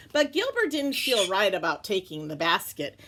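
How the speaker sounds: usual speaking voice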